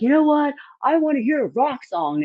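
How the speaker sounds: high mock drunk guy voice; lightly voiced rowdy heckler; imitating a drunk fan. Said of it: "he was like".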